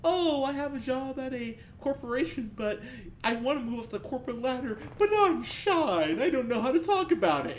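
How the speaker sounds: mocking the movie's shy heroine, pitched high. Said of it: "it's her whole, like".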